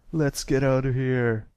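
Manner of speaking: loud call to run; fleeing shout. A narrator's reading. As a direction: shout it while bolting away. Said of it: "the brown colt shouted as he bolted away".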